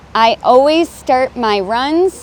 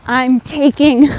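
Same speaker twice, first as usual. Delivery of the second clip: ordinary talk, nothing marked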